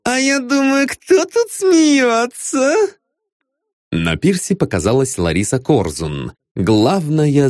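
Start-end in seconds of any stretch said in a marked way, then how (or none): 0.0-2.7 high, slow, teasing woman; sing-song flirty diva; high female voice. A narrator's reading. Lara arrives, teasing.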